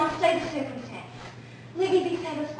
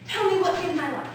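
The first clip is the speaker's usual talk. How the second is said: usual speaking voice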